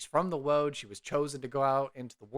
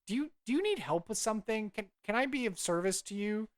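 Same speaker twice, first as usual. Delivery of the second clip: high, quick, eager offer; apologetic young scholar; 9 semitones higher than usual. Acted. Young cleric offers help to the old druid, eager and high.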